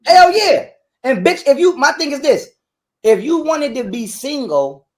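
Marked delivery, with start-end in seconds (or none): 0.1-0.7 much louder, higher hell yeah; emphatic agreement. Real, not acted. Agreeing with the host loudly and fired up. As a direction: shout your agreement.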